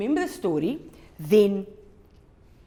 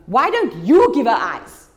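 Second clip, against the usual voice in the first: loud, higher, slowed shout; shouting at dad; 5 semitones higher, 10 dB louder than usual. Acted. Son shouting at his father in the car, loud. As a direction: hurl it at him, loud and slow. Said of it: "he shouts at his dad".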